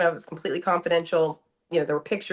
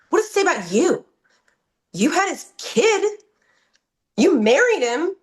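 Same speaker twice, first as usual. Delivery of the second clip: high-pitched, incredulous retold challenge; mock the judge's indignant questioning, pitch leaping up; accusing judge; 10 semitones higher, 3 dB louder than usual. Judge confronting wife in court, retold in high accusing indignation.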